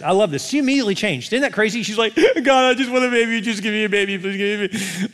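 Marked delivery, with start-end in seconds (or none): 2.1-5.1 high, hammed-up begging; desperate pleading. Preacher as Hannah begging God for a baby, desperate high pleading.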